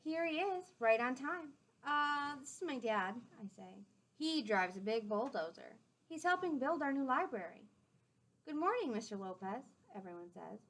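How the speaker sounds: gentle storybook read-aloud; clear, friendly storytime voice; reading to children. A narrator's reading. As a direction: read it out to the class like a picture book. Reading a picture book aloud.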